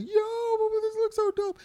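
very high, loud, losing it with excitement; hyped friends. Retold or imitated. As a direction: shriek it like a hyped fan. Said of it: "They're like".

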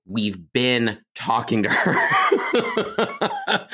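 drawn-out retold exclamation dissolving into laughter; breaking into laughter. Retells parents' words, breaking into laughter.

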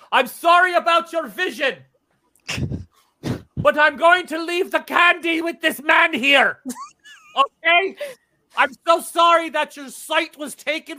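high, loud panicked apology; flustered shout. Acted. Peddler panicking at the protector, loud and high. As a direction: blurt it out too loud and high.

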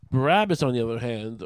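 ordinary delivery

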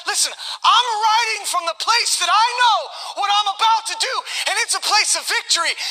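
high, forceful voice of a victor; triumphant resolve. Retold or imitated. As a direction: declare it boldly as a conquering Jesus. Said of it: "he's not in a somber, broken".

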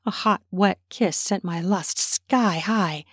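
ordinary delivery

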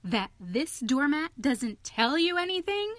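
high, incredulous question; excited, pressing challenge. A narrator's reading. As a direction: rise in disbelief to the end.

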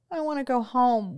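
put-on high, loud child's wail; young boy wailing to his mother on the cliff. A narrator's reading. Frightened boy wails to go home, high and loud. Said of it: "wailed John".

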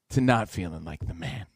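low, soft comic letdown; deflated. Retold or imitated. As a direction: let it sink low and quiet, deflated. Contrast of confident with deflated.

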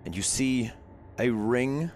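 eerie scene narration. A narrator's reading. Game master's scene setting.

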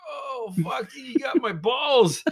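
high, drawn-out pained groan; mock agony. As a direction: moan it high and slow, imitating your hurt self. Retold cry of pain after kick.

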